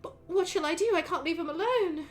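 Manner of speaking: lightly voiced fretful elf mother; elf mother pleading. Elf mother pleading with the player about her son. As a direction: wring your hands over an impossible choice. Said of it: "she looks at you and says".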